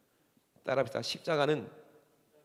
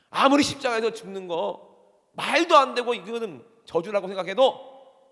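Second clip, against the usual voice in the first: loud, raised, drawn-out proclamation; forceful preaching; 7 semitones higher, 12 dB louder, slower than usual. Real, not acted. Preacher driving home God's will to congregation, fervent and loud. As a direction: belt out each phrase with full conviction.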